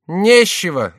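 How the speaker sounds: high, loud jester jibe; mocking jester. A narrator's reading. Jester Chicot mocking the king's grief. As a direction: toss off a teasing jibe. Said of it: "сказал Шико".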